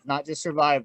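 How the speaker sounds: usual speaking voice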